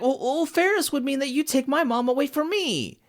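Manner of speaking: very high-pitched mock plea; hammed-up, high snivelling protest; mock pleading. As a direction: imitate a snivelling man pleading, voice pushed way up. Voices pathetic villain bargaining for himself.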